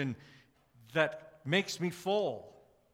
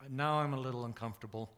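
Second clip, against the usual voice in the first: ordinary delivery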